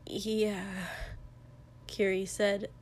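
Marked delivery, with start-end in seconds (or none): 0.0-0.9 quiet, slightly hoarse reply; a little hoarse. A narrator's reading. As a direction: answer softly with a touch of hoarseness. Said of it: "voice a little hoarse".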